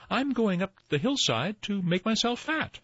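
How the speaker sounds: high-pitched little goat voice; tiniest billy goat. A narrator's reading. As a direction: pipe up as the smallest goat. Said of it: "said the tiniest billy goat Gruff".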